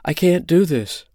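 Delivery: high-pitched, frightened cry; loud panicked repeat. A narrator's reading. Holly panics in the straps, loudly protesting. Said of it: "then repeated it loudly".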